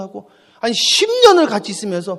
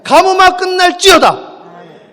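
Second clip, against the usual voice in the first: 가뭄아 proclaimed very slowly and loudly, louder and higher; loud slow decree; 9 semitones higher, 11 dB louder, much slower than usual. Shown as a demonstration. Pastor prompting the amen with a louder decree. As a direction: command the drought to end, full voice. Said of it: "아멘을 하셔야지".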